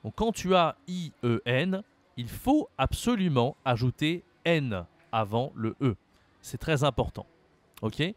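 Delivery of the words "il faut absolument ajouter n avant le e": ordinary talk, nothing marked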